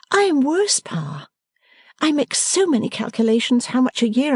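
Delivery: playful daughter fussing at her father; Bella, young daughter. A narrator's reading.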